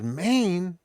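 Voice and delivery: high, loud imitation of a stranger's reaction; mock-amazed outburst; retold exclamation. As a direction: call it out, imitating an excited stranger. Retold guy reacting to license plate.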